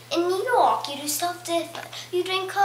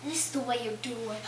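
ordinary talk, nothing marked

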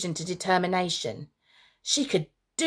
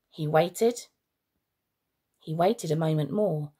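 ordinary delivery, nothing marked

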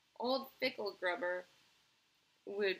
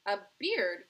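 loud outcry of disbelief; Veruca Salt; 9 dB louder than usual. A narrator's reading. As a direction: cry it out, appalled question. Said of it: "cried Veruca Salt".

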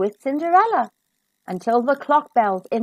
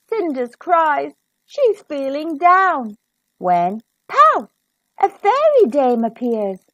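ordinary delivery

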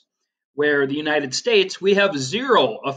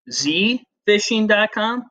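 Z fishing dot com said slowly, with the letter Z pitched up high; spelled out slowly; 7 semitones higher than usual. Shown as a demonstration. Explaining the confusing website name to students: slow, spelled out. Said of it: "but it was like E".

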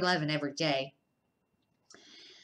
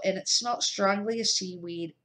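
usual speaking voice

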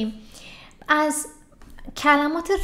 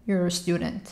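quick natural contraction; contracted quickly; much slower than usual. Shown as a demonstration. Teacher modeling the contracted form. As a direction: blend You're a into one beat. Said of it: "مخفف کردم".

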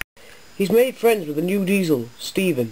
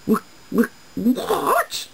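stammered, shocked squawk jumping high; 11 semitones higher, much slower than usual. A narrator's reading. Finch stunned at losing the express, squawking. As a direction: sputter it out, then squawk.

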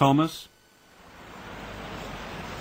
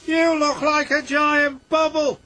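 high, bright little engine voice, louder; peeping Percy; 5 dB louder than usual. A narrator's reading. Percy teasing Thomas at the washdown, high peep. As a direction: squeak it out like a small cheeky engine. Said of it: "peeped Percy".

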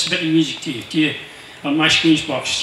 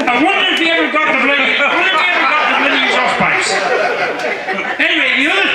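amused; 8 semitones higher, 4 dB louder, faster than usual. Real, not acted. Amused after reading joke letter.

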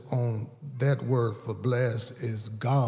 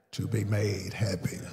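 whispered; 4 dB quieter than usual. Real, not acted. Sermon point trailing to whisper.

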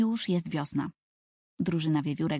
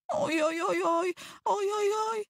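high wailing oj oj oj; high-pitched childish cries, rising; 14 semitones higher than usual. Acted. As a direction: wail like a frightened little boy. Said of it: "Tomek zaczyna wrzeszczeć".

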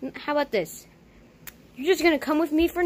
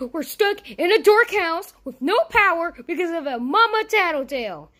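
higher, louder, fast frightened cartoon voice; a jittery sidekick who wants to run; scared Jerry voice; 5 semitones higher, 4 dB louder than usual. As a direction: panic like a timid sidekick. Jerry character talks scared.

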